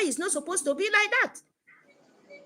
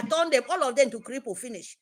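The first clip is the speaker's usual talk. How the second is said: usual speaking voice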